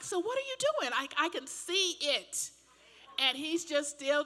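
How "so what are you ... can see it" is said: pitched way up in disbelief; exasperated high question. Retold or imitated. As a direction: squeak out the question at the driver. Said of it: "and I'm like".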